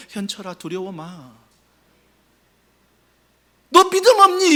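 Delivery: harsh rebuke. Shown as a demonstration. Pastor showing the wrong, harsh father, mock rebuke. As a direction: snap at the faithless, hammed-up. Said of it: "이게 아니라".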